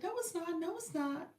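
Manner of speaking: high mock denial; high imitation, breaking into laughter; mimicking fibbing viewers. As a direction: pitch it up to imitate their denial.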